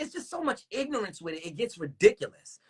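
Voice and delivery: normal talking voice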